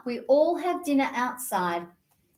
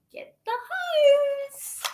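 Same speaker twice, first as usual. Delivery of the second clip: put-on kid calling out; 14 semitones higher, much slower than usual.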